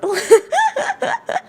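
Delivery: loud high giggle; shrill laughing fit; high embarrassed laugh. Real, not acted. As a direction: burst out laughing at yourself. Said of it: "my best Russian grandma".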